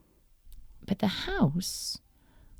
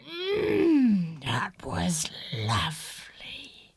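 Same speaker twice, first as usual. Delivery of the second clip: satisfied whisper; slower than usual. Acted. Satisfied wolf after eating.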